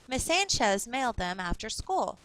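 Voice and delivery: normal talking voice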